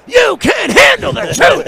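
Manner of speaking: very high, loud, drawn-out roar; full-out movie shout. Retold or imitated. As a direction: bellow it slowly as a big dramatic outburst. Shouts film line at Nicholson.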